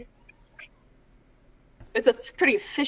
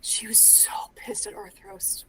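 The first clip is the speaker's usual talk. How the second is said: whispering; 10 dB quieter, slower than usual. Real, not acted. Whispered aside about character.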